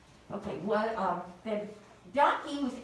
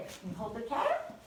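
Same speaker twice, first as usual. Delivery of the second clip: usual speaking voice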